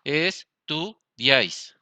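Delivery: "Estudiáis" stretched out, every syllable apart; teacher sounding out "Estudiáis" very slowly; very slow syllable demo. Shown as a demonstration. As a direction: draw "Estudiáis" out very slowly for the class. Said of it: "De tres sílabas".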